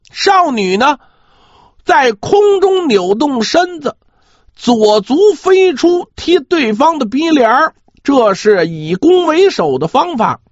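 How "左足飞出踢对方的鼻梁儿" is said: ordinary delivery, nothing marked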